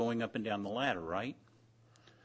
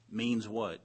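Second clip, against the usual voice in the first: normal talking voice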